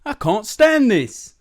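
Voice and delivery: high, loud, drawn-out complaint; acted exasperated cry; exasperated bear. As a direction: wail slowly at the end of your patience. Mister Bear fed up with noise.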